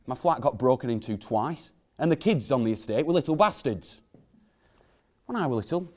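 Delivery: ordinary talk, nothing marked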